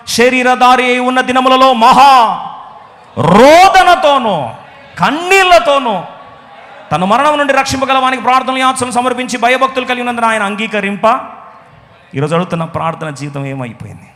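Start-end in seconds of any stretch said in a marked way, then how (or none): 0.1-11.3 loud, high proclaiming read; preaching at full force. Acted. Preacher reading Hebrews to the church, loud and forceful. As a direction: declaim the verse with force.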